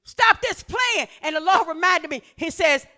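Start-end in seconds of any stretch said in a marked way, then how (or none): 0.0-1.1 high, shouted commands; forceful preaching. Acted. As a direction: call the people to order at full voice.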